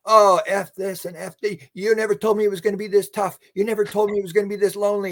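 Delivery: high, fast-building mock tirade; angry swearing. Man protesting to the beings, in an imitated angry rant. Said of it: "he started swearing at them".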